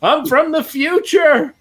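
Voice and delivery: high, slow, urgent cry; frantic time traveler. Retold or imitated. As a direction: plead from the future in a high voice. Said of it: "I wanna run out and be like".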